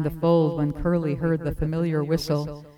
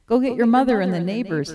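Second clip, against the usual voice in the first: excited; 5 semitones higher, 5 dB louder, faster than usual. A narrator's reading. Joyful shepherd sends sons off.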